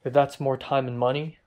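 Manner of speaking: usual speaking voice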